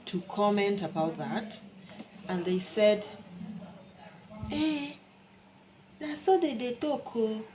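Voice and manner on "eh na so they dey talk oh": high-pitched mocking Nigerian pidgin; imitating a Nigerian woman's pidgin, high and teasing; Nigerian pidgin quote. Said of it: "That means that's how they used to talk".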